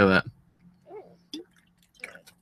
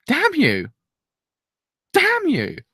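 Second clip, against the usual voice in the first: excited anime fan; 12 semitones higher, much slower than usual. Real, not acted. Cursing the show.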